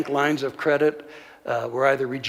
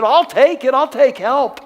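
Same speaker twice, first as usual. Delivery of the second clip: much higher and louder, grabbing at the offer; desperate eager cry; 10 semitones higher, 8 dB louder than usual. Retold or imitated. Ron crying out to his pastor, desperate and high. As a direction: blurt it out like a drowning man reaching for a rope.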